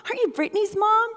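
high-pitched mock recognition, climbing each time; acted repeated high question; imitated acquaintance. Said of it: "without someone saying".

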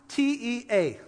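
letters "T E A" spoken one by one; spelled out letter by letter. Shown as a demonstration. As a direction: spell "T E A" one letter at a time, each letter said separately. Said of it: "tea time".

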